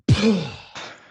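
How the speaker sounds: loud release sigh with a shoulder drop; big loud exhale. Shown as a demonstration. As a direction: let it all fall out in one sigh. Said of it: "let's try it".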